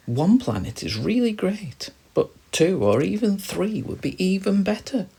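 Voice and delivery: high, light little star; an eager young star, voice lifted high; high childlike star voice. A narrator's reading.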